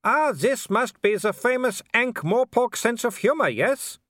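very high, loud mocking villain; Wolfgang. A narrator's reading. A werewolf mocks his prey's humour, calling high. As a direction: call it out in a taunting put-on voice. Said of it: "It was Wolfgang's voice".

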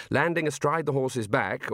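plain reading voice

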